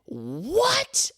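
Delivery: high, stunned disbelief; sharp rising what; shocked what. Retold or imitated. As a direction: react as if you can't believe it. Shocked reaction to the assault.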